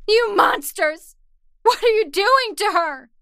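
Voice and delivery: high, loud furious cry at the monsters; screaming accusation. Acted. The captive screams at the faceless ones, furious. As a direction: scream at them as if they're hurting her. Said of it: "I started to weep and scream".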